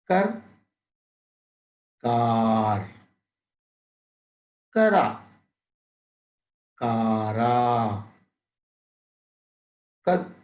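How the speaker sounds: word-list drill, one word at a time with long pauses; slow drill reading. Shown as a demonstration. Teacher drilling short and long vowels: slow, careful recitation. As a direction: read each word deliberately for pupils to copy.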